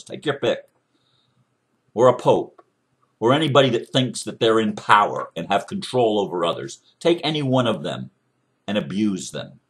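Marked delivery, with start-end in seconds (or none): none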